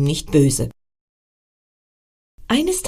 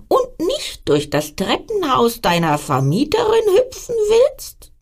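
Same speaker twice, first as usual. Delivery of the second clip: frog voice; 7 semitones higher than usual. Acted. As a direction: croak out the idea like a clever frog. Frog gives Emil an idea.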